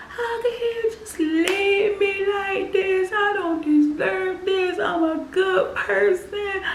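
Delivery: high, drawn-out wailing re-enactment; boo-hoo crying. Retold or imitated. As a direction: act out a slow, whiny boo-hoo lament. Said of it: "I was boo-hoo crying".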